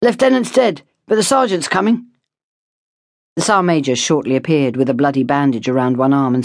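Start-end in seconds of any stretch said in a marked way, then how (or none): 0.0-2.1 urgent report. A narrator's reading. Soldier reporting under fire.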